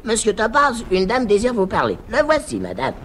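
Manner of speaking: high, put-on doorman voice; concierge voice. Concierge announcing a lady to Topaz, high and stiff. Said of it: "dit d'une voix de nuque".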